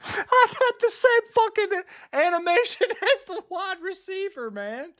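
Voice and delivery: high, loud words through real laughter; laughing in disbelief. Real, not acted. Streamer laughing at a copied animation, high and loud. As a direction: laugh out the words at the glitchy game.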